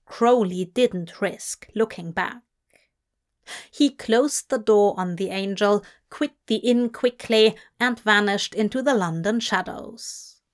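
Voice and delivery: ordinary delivery, nothing marked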